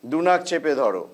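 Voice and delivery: slow, measured breathing instructions; guided breathing cues. Real, not acted. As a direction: call each breath step calmly. Leads alternate nostril breathing.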